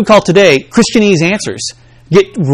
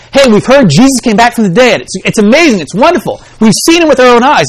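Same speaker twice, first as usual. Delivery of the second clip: excited disciples; 6 semitones higher than usual. Retold or imitated. Disciples report the resurrection.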